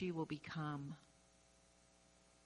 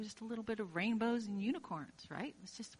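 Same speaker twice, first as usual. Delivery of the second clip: ordinary talk, nothing marked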